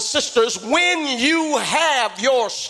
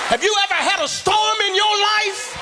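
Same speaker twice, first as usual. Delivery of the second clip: climactic sermon whoop; 7 semitones higher than usual. Acted. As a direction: lift the church up with a chanted whoop. Preacher building to whooping climax.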